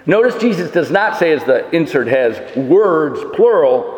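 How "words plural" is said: "words plural" slow, stressing the s; emphasize "words" plural. Shown as a demonstration. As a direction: drag out "words" and lean on it. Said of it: "but word singular".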